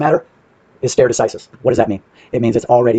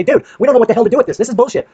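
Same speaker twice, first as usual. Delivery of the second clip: higher, fast mock-exasperated bureaucrat; sarcastic higher voice for the agency; mocking quote; 10 semitones higher than usual. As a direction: mimic the clueless office complaining, pitched up and rushed. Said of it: "they can't come back at you and say".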